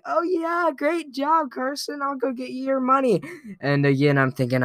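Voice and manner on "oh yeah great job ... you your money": high, soft sweet granny voice; grandma gently praising her grandson. Retold or imitated. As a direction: pat the kid on the head with your voice. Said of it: "And she was like".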